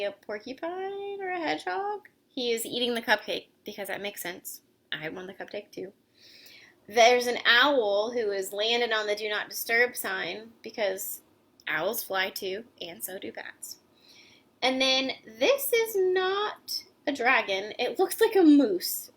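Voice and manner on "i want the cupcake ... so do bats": plain reading voice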